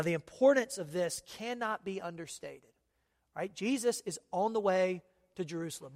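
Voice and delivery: ordinary talk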